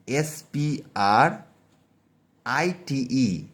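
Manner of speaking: letters spelled very slowly; slow spelling. Shown as a demonstration. Teacher spelling out the letters slowly for students. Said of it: "যেমনটা তোমাদের বলি".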